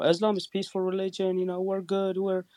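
high, sing-song mock earnestness. Streamer parodies app debaters professing peace, in a high mock-earnest voice. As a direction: insist in a high put-on voice, parodying. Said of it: "come on the app and say".

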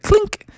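high-pitched switch sound; flashlight clink. Acted.

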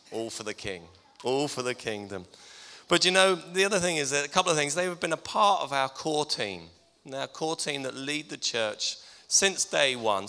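usual speaking voice

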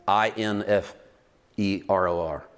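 spelling it letter by letter; spelled out. Shown as a demonstration.

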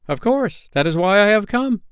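put-on high, loud, firm boy; insist, a touch sternly; a little stern. A narrator's reading. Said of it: "he added a little sternly".

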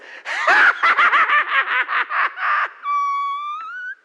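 huge, high, long belly laugh; booming mock guffaw that keeps going; God's laughter. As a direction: laugh loud and long, hammed-up. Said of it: "And God goes".

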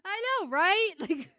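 laughing in disbelief at how absurd he was. Real, not acted. Telling her friend about the customer, laughing disbelief.

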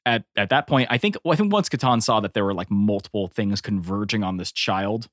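normal talking voice

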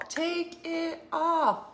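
high, drawn-out mock heckle; mom's mock holler. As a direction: stretch it long and high. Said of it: "she was all".

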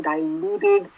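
ordinary delivery

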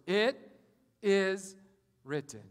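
slow, spaced out with pauses; each word set apart: It... is... written; emphatic scripture quote. Acted. Jesus answering Satan, slow emphatic.

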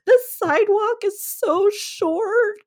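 high, slow, mock-crying whine; mock tearful whine. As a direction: imitate your own silly crying. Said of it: "look at the sidewalk and be like".

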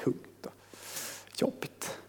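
barely voiced, very soft and drained; weighed down. Shown as a demonstration. Preacher showing how saying it feels, heavy near-whisper. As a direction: sink under the burden, almost no voice. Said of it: "känner man nästan så här".

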